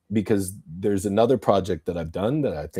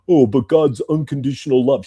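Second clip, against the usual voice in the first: high, louder mocking whine; mocking believer; 4 semitones higher, 6 dB louder than usual. Ranting podcaster mocks believers' slogan in a whiny voice. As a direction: sneer out the cliche in a silly voice.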